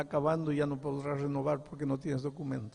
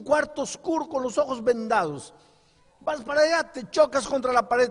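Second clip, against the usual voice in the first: higher and louder, pressing each line; fiery preaching; 10 semitones higher, 6 dB louder than usual. Acted. Pastor warns the congregation about lies, fiery preaching. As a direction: preach it hard, warning the flock.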